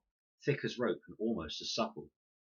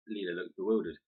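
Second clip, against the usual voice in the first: ordinary delivery, nothing marked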